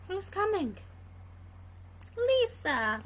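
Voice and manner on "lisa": ordinary delivery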